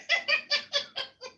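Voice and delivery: high laugh. Real, not acted. Laughs after own joke.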